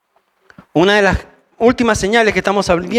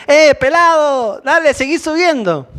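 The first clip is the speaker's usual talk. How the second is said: higher, slow taunting call of rude youths; mocking jeer; 7 semitones higher than usual. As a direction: jeer at the old prophet from below. Youths mocking old Eliseo.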